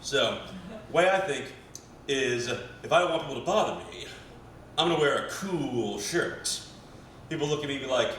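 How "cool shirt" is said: "cool" stretched out long and slow; drawn-out COOL. Real, not acted. Comic bragging about his protective clothing, drawn-out COOL. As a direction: linger on the vowel of "cool". Punchline word drawn out longer.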